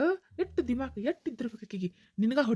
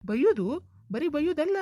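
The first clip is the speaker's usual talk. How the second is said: angry retort; 4 semitones higher, 5 dB louder than usual. Acted. Husband scolds woman in a quarrel.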